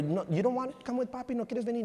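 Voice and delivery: high-pitched coaxing daddy talk; retold sing-song plea to a little one; coaxing a toddler.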